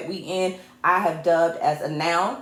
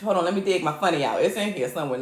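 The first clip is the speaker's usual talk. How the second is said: ordinary talk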